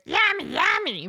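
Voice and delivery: high, loud creature chomping cry; little shark's cry. Retold or imitated. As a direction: mimic a cute critter's loud, high munching. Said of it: "your teammates hear".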